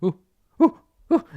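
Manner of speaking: high, short monkey "ooh" calls; nervous monkey hoots. Acted. Game master imitating monkeys across the bridge, nervous hoots. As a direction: hoot like an uneasy monkey. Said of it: "nervous monkey sounds from over there".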